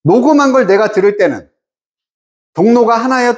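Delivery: usual speaking voice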